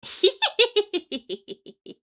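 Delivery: high, loud giggle tailing away. Real, not acted. Streamer giggling to herself on stream, high giggle. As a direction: let out a rapid high giggle, dying off. Streamer laughs on her own.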